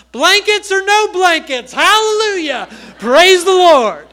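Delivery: loud, high-pitched shout of praise; top of her lungs. Retold or imitated. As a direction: cry out as if finally bursting. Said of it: "at the top of her lungs said".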